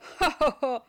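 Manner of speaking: booming laugh; hearty laugh. A narrator's reading. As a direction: laugh out loud, big and open. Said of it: "lacht Petrus schallend".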